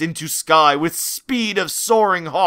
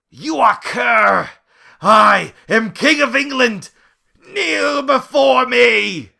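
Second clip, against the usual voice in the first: loud, slow, higher defiant tyrant roar; dark lord; 7 semitones higher, 5 dB louder than usual. Acted. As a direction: roar like a furious king. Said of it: "roared King George three in defiance".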